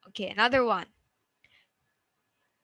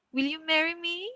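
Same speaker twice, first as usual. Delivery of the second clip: higher, climbing steadily to "me"; rising intonation; 5 semitones higher than usual. Shown as a demonstration. Teacher gives a yes-no example, rising intonation. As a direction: end high on "me" as a question. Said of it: "we have the rising intonation".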